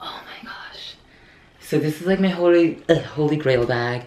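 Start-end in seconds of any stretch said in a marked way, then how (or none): 0.1-1.0 soft whispered wonder; awed whisper. Real, not acted. Gazing at her holy grail bag, an awed whisper. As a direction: barely breathe it out in awe.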